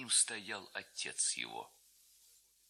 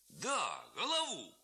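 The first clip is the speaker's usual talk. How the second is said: emphatic reveller; 7 semitones higher, slower than usual. A narrator's reading. Reveller confirms.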